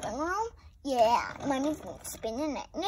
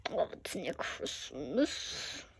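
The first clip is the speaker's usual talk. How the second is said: whisper; 10 semitones lower, 6 dB quieter, slower than usual. Pretend. Aside whisper during play.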